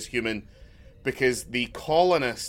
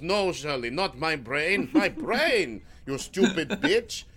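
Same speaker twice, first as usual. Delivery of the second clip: slow, higher, hammed-up exasperation; mock-tragic doctor, slowed and raised; Mel Brooks melodrama; 4 semitones higher, slower than usual. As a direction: spit out the insult like an old comedy hero. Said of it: "it's like a Mel Brooks comedy".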